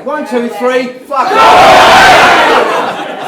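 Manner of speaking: loud count then yelled fuck off; shouted count and swear. Real, not acted. Speaker leading the room in a loud shout. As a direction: count up and explode.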